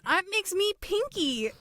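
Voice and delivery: high cartoon sidekick squeak; squeaky Pinky. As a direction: pitch up like a silly sidekick. Joking self-comparison.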